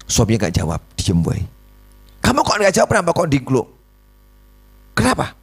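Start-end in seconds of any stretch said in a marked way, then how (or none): 2.2-5.3 high, insistent questioning wife; pressing wife. Retold or imitated. Wife presses her silent husband why he hangs his head, high and nagging. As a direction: needle him in a high nagging voice.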